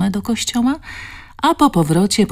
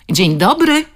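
louder and higher, a hello from the gate; calling out greeting; 5 semitones higher, 6 dB louder than usual. A narrator's reading. The director greeting the women from the gate, calling out. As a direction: call it out cheerfully from afar. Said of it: "zawołała".

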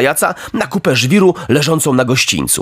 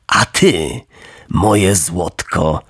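low, slow and syrupy; lowered fawning coachman's voice; oily coachman; 6 semitones lower, much slower than usual. Acted. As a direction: wheedle the puppet sweetly. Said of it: "z ugrzecznieniem".

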